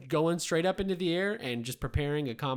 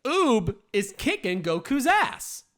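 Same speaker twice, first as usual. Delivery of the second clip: put-on high, loud and slow, like reading to kids; sing-song storybook reading; kindergarten teacher voice; 11 semitones higher, 10 dB louder, slower than usual. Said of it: "reading that like a kindergarten teacher".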